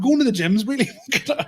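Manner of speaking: laughing through the words. Real, not acted. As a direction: giggle as you say it. Repeats line while laughing.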